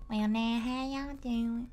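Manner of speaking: put-on slow Southern old-lady drawl, lightly done; a mild imitation of a folksy Southern granny greeting; Southern accent, lightly put on; Gmail voice. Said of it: "the Gmail voice".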